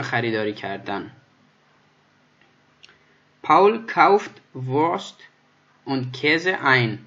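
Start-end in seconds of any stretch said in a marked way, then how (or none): 3.4-7.0 very slow, every word drawn out; slow model reading. Shown as a demonstration. Teacher to students, very slow, drawn-out example sentence. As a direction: spell the sentence out for beginners. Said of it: "teacher reads example sentence".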